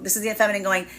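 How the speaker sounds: ordinary talk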